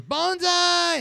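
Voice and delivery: high, drawn-out yell; Mister Miyagi. Retold or imitated. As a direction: holler it back as a battle cry. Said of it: "Mister Miyagi yells".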